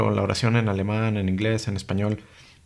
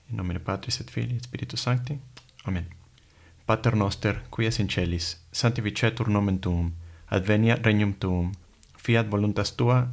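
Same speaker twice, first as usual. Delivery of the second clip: prayer recitation. Shown as a demonstration. Recites Latin prayers as example.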